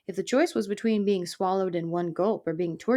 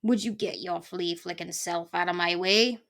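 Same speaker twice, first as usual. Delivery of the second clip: lightly voiced irate porcupine; a touch of blustering impatience; mild bellow. A narrator's reading. Porcupine ordering the mouse aside, mild bluster. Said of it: "Eric bellowed".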